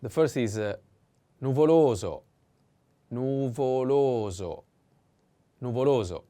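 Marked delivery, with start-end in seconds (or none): 1.4-2.2 "nuvoloso" with LO punched louder; stress on second O. Shown as a demonstration. Teacher introduces the first adjective, "nuvoloso" stressed loudly on LO. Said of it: "The accent is on the second O". 3.1-4.6 "nuvoloso" drawn out syllable by syllable; slow model pronunciation. Shown as a demonstration. Teacher models the first adjective for learners, slow "nuvoloso".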